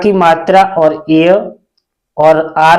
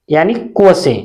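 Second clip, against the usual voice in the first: usual speaking voice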